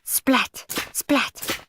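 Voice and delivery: plain reading voice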